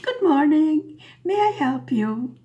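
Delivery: a bright, loud call-centre hello; mock-cheerful customer service voice; cheery phone greeting. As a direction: greet the caller like a chipper operator. Operator answers the phone.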